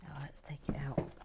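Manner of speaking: muttering to herself. Real, not acted. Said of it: "I'm just muttering to myself today".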